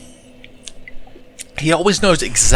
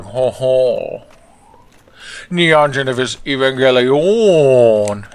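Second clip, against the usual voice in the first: slow, grand title announcement; gleeful announcer; 3 dB louder, much slower than usual. Retold or imitated. Ron announcing the show title, slow and grand. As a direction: savor each word of the title. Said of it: "And Ron was like".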